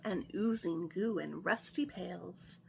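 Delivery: ordinary delivery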